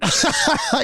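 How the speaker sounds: high burst of real laughter. Real, not acted. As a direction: break up laughing at the story. Interviewer reacts to the story.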